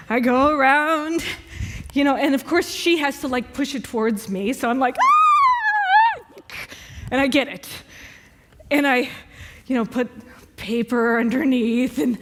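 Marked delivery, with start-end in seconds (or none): 5.0-6.5 very high, loud shriek of disgust; startled shriek. Retold or imitated. Spider saver facing a cockroach, high startled shriek. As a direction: squeal as the roach comes at you.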